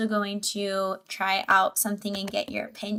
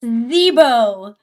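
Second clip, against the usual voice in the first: ordinary talk, nothing marked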